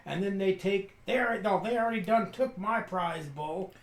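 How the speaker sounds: high-pitched rustic complaint; aggrieved older farmer. Acted. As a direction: pour out a farmer's grievance against the soldiers. Game master voices Brady.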